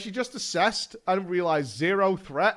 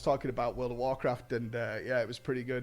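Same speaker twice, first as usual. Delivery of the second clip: a hushed, much softer, fake-casual chatter; mock casual; 10 semitones lower, 10 dB quieter, faster than usual. Friends turning away from a fight, mock innocent small talk. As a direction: pretend you weren't watching at all, murmured low. Group pretending not to watch.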